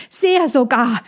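high, eager little hedgehog; plucky, agitated interjection; excited cry. A narrator's reading. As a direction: chime in, worked up and bold. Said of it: "rief der Igel".

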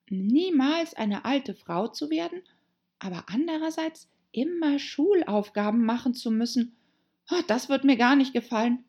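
put-on high, lively girl-heroine voice; pondering Alice. Alice debating with herself about never growing older, high and dismayed. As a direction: weigh it up aloud, high and expressive.

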